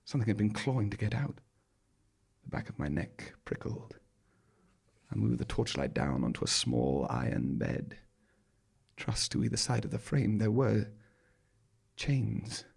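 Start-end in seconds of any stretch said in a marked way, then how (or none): none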